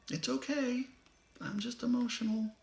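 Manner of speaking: very high, sugary mock reassurance; mocking the phrase. Warning viewers off the phrase, mocking it in a high sweet voice. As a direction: parody the fake-fine excuse, lilting and high. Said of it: "never use that phrase again".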